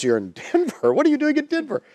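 loud, high-pitched mock disbelief; astonished. Brother learns sibling is in Denver, imitated astonishment. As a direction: exclaim it incredulously, voice shooting up.

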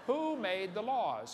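slow emphatic question. Retold or imitated. To the professor, demanding an answer, slow and emphatic. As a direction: lean on each word to corner him.

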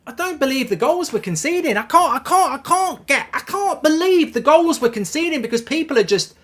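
high-pitched, stammering exasperation; real incredulous fan rant; stunned disbelief. Real, not acted. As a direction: struggle to get the words out, voice pitched high. Stunned at the goals conceded.

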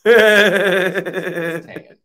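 laughing. Real, not acted. Insisting on the suit color.

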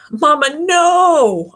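loud, high, stretched-out cry; anguished plea to Mama; desperate yell. A narrator's reading. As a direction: scream it as the money falls. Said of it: "I yell".

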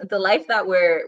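ordinary talk, nothing marked

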